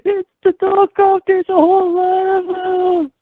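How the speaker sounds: very high, loud pretend screams; mock panicked screaming. Caller imagines crowds under falling dog copters, mock screaming. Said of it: "They would be screaming".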